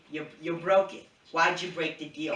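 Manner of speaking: badly faked Boston accent, indignant; terrible Boston accent. Recapping an argument over a broken deal, in a bad Boston accent. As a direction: protest in a hammed-up Boston accent. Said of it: "That was a terrible Boston accent".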